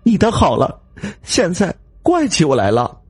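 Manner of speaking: high, tearful complaint; crying reproach. Acted. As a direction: sob back at her husband. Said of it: "一边说着，一边跟着哭了起来". (边哭边抱怨)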